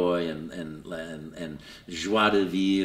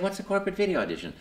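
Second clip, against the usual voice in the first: puzzled; 11 semitones higher, much faster than usual. Retold or imitated. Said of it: "I said".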